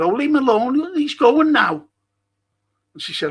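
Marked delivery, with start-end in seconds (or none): 0.0-1.9 put-on high-pitched girl's voice, a bit louder; light higher girl voice. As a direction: voice the teenage girl defending him.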